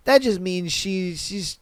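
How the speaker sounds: normal talking voice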